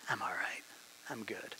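hushed, whispered reassurance to yourself; whispered self-talk. Retold or imitated. Preacher voicing a complacent believer's whispered thought. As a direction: breathe it as a private whisper.

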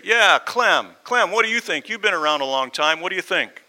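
high, loud calls from the crowd; eager shouts, pitched well up; acted crowd voices. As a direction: egg him on loudly from the floor. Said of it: "a couple voices say".

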